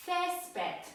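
Césped with a high first syllable; stressed first E. Shown as a demonstration. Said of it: "zaznaczamy nad E".

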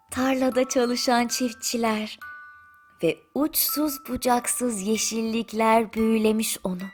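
plain reading voice

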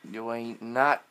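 ordinary talk, nothing marked

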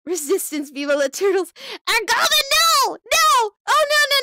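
high, loud frantic string of noes; hammed-up panicked yelling. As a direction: scream no over and over in mock despair. Viewers joining rival faction.

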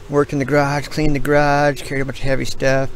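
ordinary talk, nothing marked

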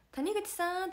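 high call of a name; mock staff calling around the rooms; imitating a called name.

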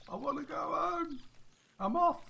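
comic high-pitched little voice; high put-on voice. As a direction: pipe it up high, pretending to plead. Voices hooked fish wanting out.

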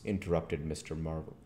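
ordinary delivery, nothing marked

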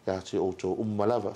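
normal talking voice

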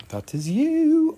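high, slow singsong "That is you"; playful high echo; drawn-out high repeat. Shown as a demonstration. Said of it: "repeat contrast".

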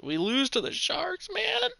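rising, exasperated complaint about the loss; exasperated cry. Real, not acted. Gamer complaining to himself about losing to the Sharks, exasperated. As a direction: whine it out, pitch climbing on man. Frustrated outburst at the game.

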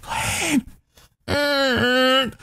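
stifled cry for help; hushed urgent calling. Acted. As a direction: call a sleeper without startling anything. Calling to sleeping friend, afraid of turtle.

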